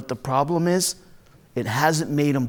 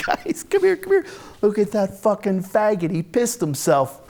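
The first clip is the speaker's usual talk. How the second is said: high squeal of glee settling into a sneering jeer; jeering through laughter; 4 semitones higher than usual. Acted. Bully shows his friends the stain, laughing jeer. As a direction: call the gang over, barely holding in laughter.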